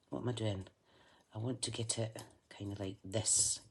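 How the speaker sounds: usual speaking voice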